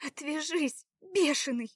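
high, sharp rejection; seething rebuff. A narrator's reading. Заряна rebuffs his advances, seething. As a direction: push him away with the voice. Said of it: "процедила Заряна".

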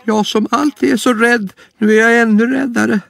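very high, scared bunny voice; a tiny frightened rabbit squeaking; timid little rabbit. Acted. Lille Skutt hearing about the lion, high scared squeak.

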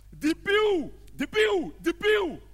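high, loud repeated cries; exultant shout. Acted. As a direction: fire off each cry high and loud. Outbidding, acted crescendo.

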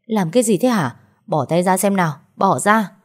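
ordinary delivery, nothing marked

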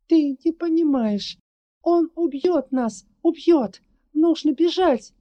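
high, loud frantic pleading; panicked shout. Acted. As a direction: beg her to run, terrified. Panicked girl pleads to flee.

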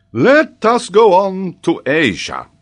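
loud, high, slow announcement; pompous professor. A narrator's reading. Geographer moves the boy's exam on, loud professorial voice. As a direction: move the lesson on grandly.

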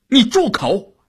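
high, sharp cry of indignation; loud angry outburst. A narrator's reading. Enraged wife rushes at her husband's critic, loud and shrill. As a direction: cut them off with a high-pitched, angry order. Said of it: "大声地说".

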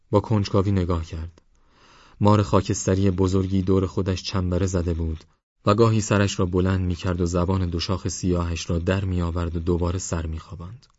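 ordinary delivery

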